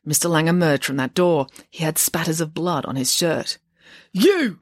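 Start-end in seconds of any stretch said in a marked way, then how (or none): 4.1-4.6 higher, louder accusing shout; accusing cry. A narrator's reading. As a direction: point and yell at him. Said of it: "cried Mister Wirtz".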